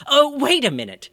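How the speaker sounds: high, loud sudden call; sudden alarm. Acted. Mayor notices his mistake.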